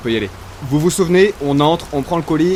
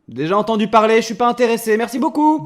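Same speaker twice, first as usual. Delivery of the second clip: high, loud brush-off; shouting a curt goodbye; loud refusal; 7 semitones higher, 3 dB louder than usual. Acted. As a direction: call out no thanks through the door. Refusing visitor through shutter.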